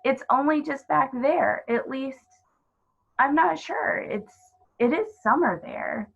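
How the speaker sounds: put-on unsure little girl, rising and eager; young girl Lucy. Lucy explains the wardrobe to the faun, lightly voiced eager girl. Lucy's voice, higher.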